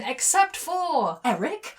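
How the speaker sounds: high, questioning surprise; blurted disbelief. A narrator's reading. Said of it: "I blurted in disbelief".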